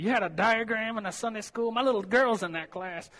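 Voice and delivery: a lightly voiced upset parent on the phone. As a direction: play the indignant mom calling the church. Imitating an upset parent's call.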